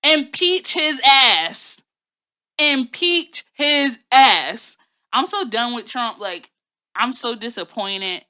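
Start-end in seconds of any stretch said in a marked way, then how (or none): 0.0-1.8 slow, loud, drawn-out impeach chant; Auntie Maxine voice. Retold or imitated. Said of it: "in my Auntie Maxine voice". 2.6-4.8 slow, loud, rising impeach call; Auntie Maxine voice. Retold or imitated. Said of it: "in my Auntie Maxine voice".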